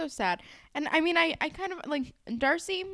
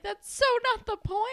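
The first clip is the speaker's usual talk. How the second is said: high-pitched, exasperated imitation; mock exasperation; 11 semitones higher, 4 dB louder than usual. Hazel to Jimmy offering to paint over it, mock exasperation. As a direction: squeal it like a fed-up girlfriend. Acts out exasperated girlfriend's retort.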